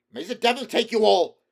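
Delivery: high, loud furious curse; ruined poet cursing his players. A narrator's reading. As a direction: hurl the curse at them.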